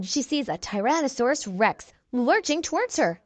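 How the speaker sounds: ordinary delivery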